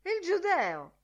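high-pitched exclamation; sudden high outcry; cried out. A narrator's reading. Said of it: "gridò Buck Mulligan".

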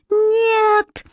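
very high, long-held "no"; horrified cry. A narrator's reading. Alex sees her brother jump, high drawn-out cry. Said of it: "заорала Алекс".

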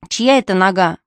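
plain reading voice

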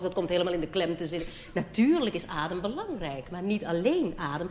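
usual speaking voice

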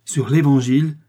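loud, fervent oath; solemn cry. A narrator's reading. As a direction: swear it aloud, urgently. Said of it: "s'écria D'Artagnan".

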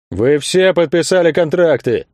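high, slow indignant exclamation; indignant circus boss. A narrator's reading. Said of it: "возмутился владелец цирка".

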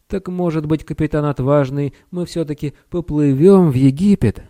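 high, pleading little wizard; small high voice, timidly asking; losing last hope. A narrator's reading. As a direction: plead with the captain, hope fading. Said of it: "теряя последние крохи надежды".

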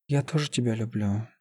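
soft, hushed love confession; hushed tender reply. A narrator's reading. Лиза answers her beloved in a dream, hushed and tender. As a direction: answer softly, as if in a lover's arms.

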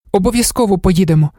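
put-on high, eager girlish exclamation; mild excited cry. A narrator's reading. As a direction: lift the pitch in sudden delight, without shouting. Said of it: "скрикнула дівчина".

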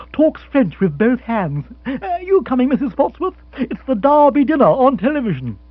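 high, loud, cheery calling along; jolly Rory. Acted. Rory calling Mrs Spotsworth to the television, cheerily. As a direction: sweep everyone along cheerily.

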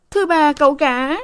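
panting maid. A narrator's reading. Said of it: "Sen hỗn hển".

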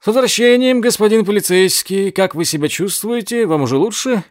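high, loud delighted greeting; enthusiastic exclaim. A narrator's reading. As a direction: greet him with gleeful enthusiasm. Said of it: "с воодушевлением воскликнул".

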